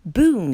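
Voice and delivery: a playful mock thunderclap; thunder boom. Goat mimics thunder.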